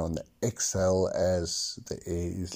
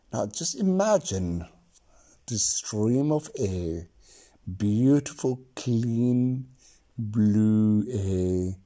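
guided meditation. Acted. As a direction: lead them gently into calm. Leads a breathing meditation.

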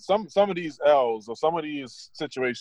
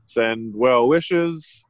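very slow; much slower than usual. Acted. Sarcastic slow delivery.

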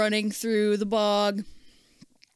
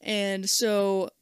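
ordinary talk

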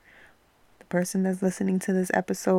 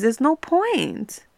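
ordinary talk

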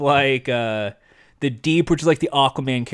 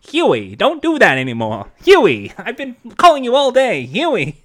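put-on old-timey Hollywood dad, higher and louder; put-on old-timey Hollywood American accent; 6 semitones higher, 4 dB louder than usual. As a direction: scold your son in old Hollywood style. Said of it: "it's kinda like old-timey Hollywood kind of accent".

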